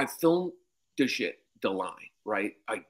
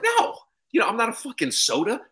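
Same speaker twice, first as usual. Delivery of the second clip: exasperated retort; 11 semitones higher than usual. Retold or imitated. Comic telling the assistant off about his name, exasperated. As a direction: snap back fed up. He snaps back at her.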